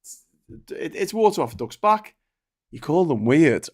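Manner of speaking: ordinary delivery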